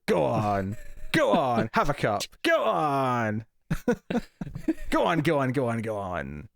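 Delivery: bad Irish accent, Father Ted women; hammy Irish mammy coaxing. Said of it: "my bad Irish accent".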